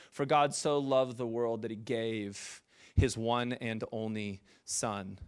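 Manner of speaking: slow, low, soft solemn quotation; scripture recitation. Acted. Preacher quoting scripture to the congregation, solemn recitation. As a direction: recite the verse slowly, letting it land.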